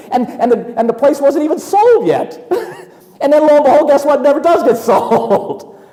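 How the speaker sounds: laughing high over the punchline. Real, not acted. Preacher laughing to the congregation at the unsold company. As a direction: chuckle through the irony.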